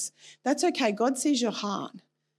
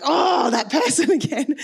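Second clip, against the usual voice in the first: exasperated laugh; 6 semitones higher, 7 dB louder, slower than usual. Retold or imitated. Annoyance comes back.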